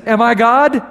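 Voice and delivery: loud, high cry of disbelief; alarmed outcry. Retold or imitated. King protesting the letter, crying out loud.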